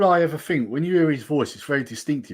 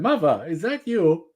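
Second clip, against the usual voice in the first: slow, raised-pitch mock impression; lightly imitated catchphrase; Christopher Walken; 6 semitones higher, slower than usual. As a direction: quote it slowly, imitating the odd cadence. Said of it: "impression of Christopher Walken saying".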